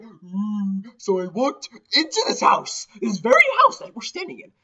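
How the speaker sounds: hammed-up ghost storyteller. House guide telling a ghost story, high and building. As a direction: spin the tale like a campfire ghost story.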